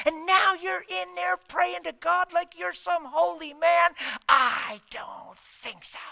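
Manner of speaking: seethe, then explode; wife's fury. Retold or imitated. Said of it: "She says".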